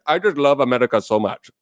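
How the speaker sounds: put-on Indian accent. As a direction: imitate an Indian English speaker. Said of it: "okay, an Indian person".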